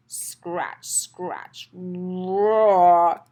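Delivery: little dinosaur roaring; building to a roar. Acted. Acting out claws, then roar.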